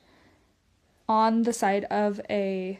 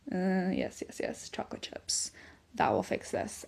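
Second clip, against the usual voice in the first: scheming whisper; 3 semitones lower, 6 dB quieter than usual. Retold or imitated. Mom voicing her sneaky chocolate plan, whispered. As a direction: hush it like a secret plan.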